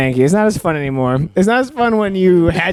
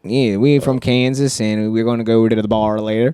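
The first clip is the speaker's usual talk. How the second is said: mock Kansas drawl; 7 semitones lower than usual. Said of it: "take it down to the south".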